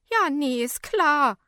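scornful calling out. A narrator's reading. Woman scoffs at fainted man's excuse, loud and sarcastic. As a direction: call it out in disbelief. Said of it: "ruft die Frau".

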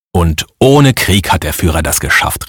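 ordinary delivery

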